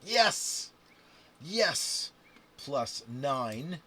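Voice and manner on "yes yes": loud high victory shout; excited cheer. Real, not acted. Player cheering a good dice roll, shouting. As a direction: yell it like you just won.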